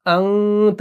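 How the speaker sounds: "अंत" with the n half-silenced in the nose; "अंत", no hard n; hidden noon. Shown as a demonstration. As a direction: hold a nasal hum in "अं" before "त". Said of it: "आवाज को हमने थोड़ा सा silent करना है नाक में".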